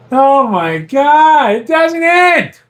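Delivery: real laughing exasperation; exasperated laughing. Real, not acted. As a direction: stretch it out in amused despair. Reacting to endless pranks.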